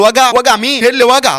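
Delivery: high, indignant questions; offended retort. Acted. Doctor answering an insult on the phone, indignant. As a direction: fire back the word in outrage.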